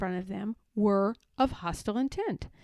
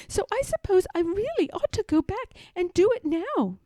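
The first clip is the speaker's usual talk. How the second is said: higher, fast nervous little voice; nervous Piglet; 8 semitones higher than usual. A narrator's reading. Piglet tries to leave Pooh, high and fast. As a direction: gabble an excuse to flee.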